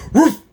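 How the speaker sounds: sharp, loud, high woof; sudden practised dog bark; dog bark imitation. Shown as a demonstration. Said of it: "I practice my sounds".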